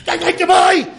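high, loud mock cyclist yelling; hammed-up cyclist warning pedestrians. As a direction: shout like a smug cyclist ringing a bell. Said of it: "Los de las bicicletas son".

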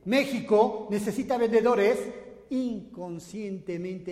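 loud, slow, emphatic keynote; rousing speech. Acted. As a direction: hammer each word to the crowd. Motivational speech climax.

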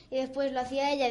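ordinary talk, nothing marked

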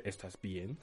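imitating a dad frozen by a hug, softly; frozen, stunned. Son retells dad stiffening at airport hug, stunned and quiet. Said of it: "quedó congelado, como de".

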